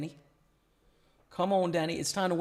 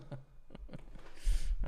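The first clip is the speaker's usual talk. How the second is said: breathy laugh. Real, not acted. Reacting to the board.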